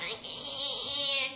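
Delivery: long drawn-out mock newborn whine; imitated baby fussing. Comedian mimicking babies that aren't calm, drawn-out whine.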